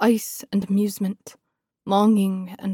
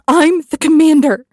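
high, loud furious shout; fiery interruption; 7 semitones higher, 10 dB louder than usual. A narrator's reading. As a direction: cut in hot enough to breathe fire. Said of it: "I cut him off, voice hot enough to breathe fire".

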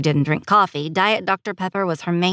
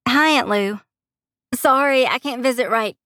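higher-pitched friendly hello to an aunt; apologetic phone greeting; 6 semitones higher than usual. Acted. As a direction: greet her brightly, then apologise.

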